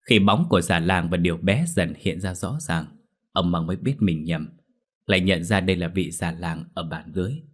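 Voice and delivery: ordinary delivery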